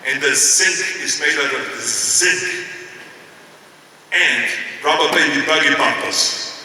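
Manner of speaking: very slow, drawn-out pronunciation exercise; laboured speech-class recitation, word by word; elocution drill. Retold or imitated. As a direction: stretch out each word like practising diction. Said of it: "the kind of things that they say over and over again".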